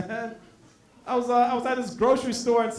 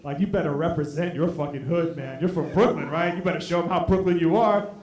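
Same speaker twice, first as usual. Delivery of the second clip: low-pitched buddy egging him on; low, incredulous prodding; goading friend; 7 semitones lower than usual. Acted. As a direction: needle your friend in a low voice. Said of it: "my boy behind me laughing his ass off".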